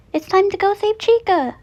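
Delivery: put-on high, loud childlike cheer; excited. Little girl thrilled about the rescue, high excited cheer.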